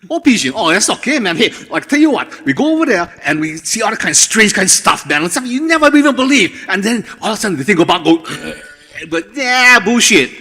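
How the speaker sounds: loud, high, rapid Hawaiian Pidgin English; old Hawaiian Pidgin. Shown as a demonstration. Storyteller shows the interviewer old Hawaiian Pidgin, loud and high. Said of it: "Can you give me an example of old Pidgin?".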